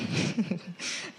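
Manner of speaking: soft, brief chuckle; small laugh. Real, not acted. Preacher amused by people's compliments on sermons, soft chuckle. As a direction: chuckle quietly to yourself. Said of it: "I'm laughing because sometimes after we preach".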